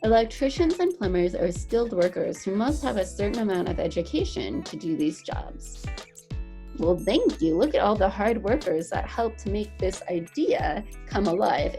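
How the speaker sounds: ordinary talk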